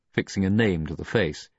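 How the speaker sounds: plain reading voice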